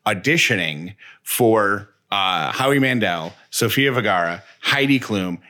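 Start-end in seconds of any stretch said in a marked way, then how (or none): none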